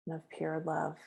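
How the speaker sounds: hushed healing visualization; guided meditation. Acted. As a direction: guide them gently into the light.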